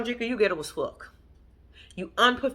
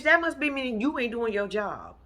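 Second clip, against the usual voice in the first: ordinary talk, nothing marked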